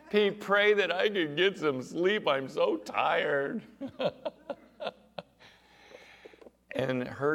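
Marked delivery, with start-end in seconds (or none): 0.1-3.6 high, loud, slow comic whine; hammed-up worn-out wail; exhausted plea. Exhausted mother pleading, acted out. 3.7-4.5 high chuckle at own punchline; high laughter. Real, not acted.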